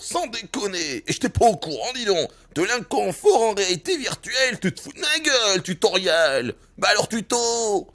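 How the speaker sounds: high, loud mock indignation; sarcastic outrage. Real, not acted. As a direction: shout sarcastically at the tutorial. (indignation sarcastique)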